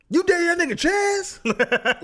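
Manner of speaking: high, loud laughing disbelief; mock outrage. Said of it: "I was literally like".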